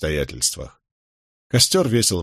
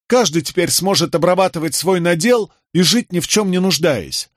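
high-pitched, fervent rallying call; rousing speech; 7 semitones higher, 3 dB louder than usual. A narrator's reading. Rebel praises lad and promises comrades a new state, fervently. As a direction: rouse the camp with conviction.

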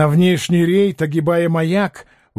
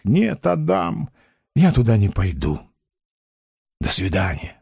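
low firm refusal; 7 semitones lower than usual. A narrator's reading. Ostap refuses, says goodbye.

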